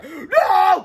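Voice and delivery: high, loud drawn-out no; desperate shout of refusal; crying out. A narrator's reading. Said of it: "cried George".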